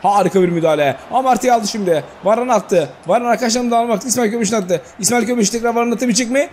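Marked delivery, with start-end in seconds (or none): 1.1-6.5 higher-pitched rolling match call; live commentary rising; play-by-play. Acted. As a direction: build the tension like a TV commentator.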